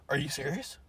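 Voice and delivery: hushed disbelief, in character; barely voiced, put-on incredulity; impression voice. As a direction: question it very softly, playing the part. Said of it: "I'm trying to do my impression here".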